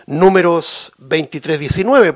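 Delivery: usual speaking voice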